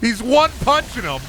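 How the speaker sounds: high, amused amazement; thrilled high-pitched call; excited exclamation. Real, not acted. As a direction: cry it out in high-pitched delight. Amazed at fight in game.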